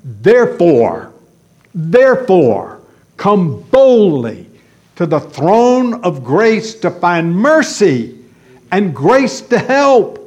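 ringing pulpit exhortation; full-force preaching. Real, not acted. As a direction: preach it out with urgency to the believers.

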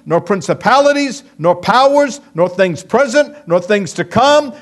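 loud, high, rising list of "nor"s; preaching at full force. Acted. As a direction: build each "nor" toward the climax.

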